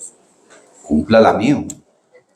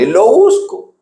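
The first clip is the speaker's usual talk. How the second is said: high excited retelling; 11 semitones higher, slower than usual. Retold or imitated. Daughter searching for phone.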